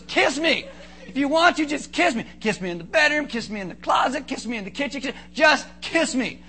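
high, emphatic mock demand; insistent wife. Preacher as wife telling husband to kiss her, playfully insistent.

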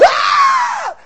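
high, loud warrior yell; battle scream. Retold or imitated. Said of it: "they'd rush you".

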